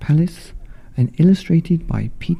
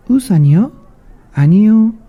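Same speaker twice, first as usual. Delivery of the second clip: high, loud little mouse refusal; high loud mouse in Korean; mouse says no; 9 semitones higher, 6 dB louder, much faster than usual. Acted. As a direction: squeak a firm no.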